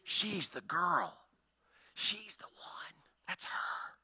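slow hushed gossip whisper; slow whispered gossip from the crowd. Retold or imitated. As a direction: whisper it like village gossip behind her back. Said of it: "everybody's gonna go".

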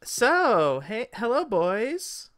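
high, loud elf voice; high-pitched elf hello; flirty elf greeting. Acted. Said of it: "one of the elves says".

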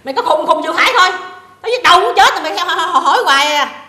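high, loud fed-up outburst; exasperated scolding. Acted. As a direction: scold a pestering daughter-in-law at full voice. Acted quarrel, fed-up mother-in-law.

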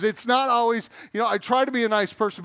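ordinary delivery

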